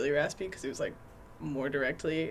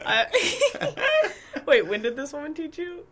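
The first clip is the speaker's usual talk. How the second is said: laughing; 10 semitones higher, 6 dB louder, slower than usual. Real, not acted. Laughing at the story.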